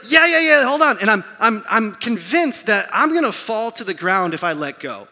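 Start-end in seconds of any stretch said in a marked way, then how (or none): 0.1-1.0 loud, high brush-off; flustered stalling. Retold or imitated. Terrified dad stalling his daughter about the video, flustered loud reply. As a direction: put her off loudly, pitched up.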